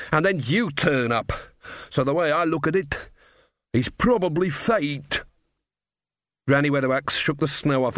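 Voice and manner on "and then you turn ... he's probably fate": dwarf king. Acted. Narrator voices troubled dwarf king.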